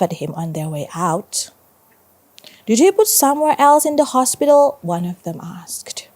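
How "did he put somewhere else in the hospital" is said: high, quick teasing question; mocking question. A narrator's reading. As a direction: toss it out as a jeering schoolkid's question. Classmate taunting Del in story.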